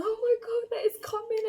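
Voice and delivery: high, loud excited squeal; mock boyfriend yelling with glee. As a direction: shout it high like he's thrilled.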